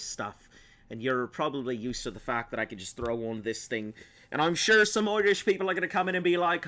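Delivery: put-on lilting Irish accent. Streamer explaining the act to viewers, put-on Irish accent. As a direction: play it up as a stage Irishman. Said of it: "Not all Irish people talk like that".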